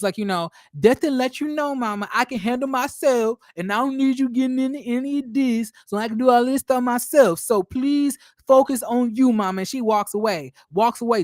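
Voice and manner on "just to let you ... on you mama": higher, sugary fake-sweet Tisha; mock Tisha voice. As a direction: play the fake-nice daughter. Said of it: "She says".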